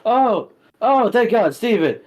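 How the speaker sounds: high, loud burst of relief; relieved exclamation. Acted. Yumi spotting Steven in the crowd, relieved exclamation. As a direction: gasp it out, thankful to see them. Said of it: "she sees you and she said".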